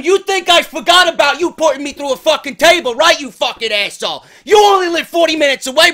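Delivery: loud, heated rant at a rival, pitched high; angry rant. Acted. Host rants at wrestler Grim over an old table spot: loud, angry callout. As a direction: call out the rival with mock fury.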